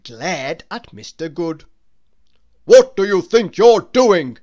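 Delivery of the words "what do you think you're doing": loud, furious bellow; thundering. A narrator's reading. Angry man bellowing at Mister Good over his hat. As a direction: roar it at the stranger. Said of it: "he thundered".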